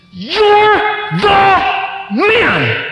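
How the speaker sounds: very high scream, each word stretched out; enraged screaming. Retold or imitated. Counselor up in the husband's face, enraged scream. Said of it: "sticks his finger in my face".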